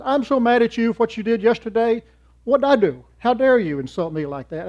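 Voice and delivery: loud, high angry outburst; harsh and angry. Retold or imitated. Angry person harshly confronting you, loud and high. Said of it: "comes up to you harshly and says".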